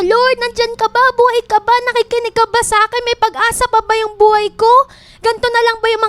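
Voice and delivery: high, pleading cries to God, pushing hard; desperate crying out. Acted. Hurting person crying out to the Lord, desperate shout. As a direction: call out to heaven like someone at the end of their rope. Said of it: "sumisigaw na siya".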